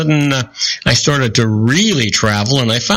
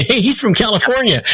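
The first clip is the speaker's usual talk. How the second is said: higher-pitched impressed onlooker; pitched-up, playful call-out; mock excited exclamation; 9 semitones higher than usual. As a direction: imitate someone excitedly pointing out a celebrity. Acting out Europeans spotting a Californian.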